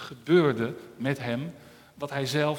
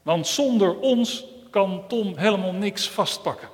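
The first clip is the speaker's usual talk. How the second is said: hands character; 5 semitones higher, 5 dB louder than usual. Acted. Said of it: "zeiden de handen".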